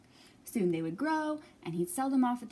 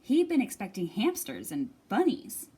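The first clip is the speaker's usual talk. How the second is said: ordinary delivery, nothing marked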